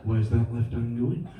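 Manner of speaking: low, slow hushed commentary; snooker voice. Shown as a demonstration. Boxing commentated in a hushed snooker voice. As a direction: murmur it like calm snooker commentary. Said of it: "with a snooker voice".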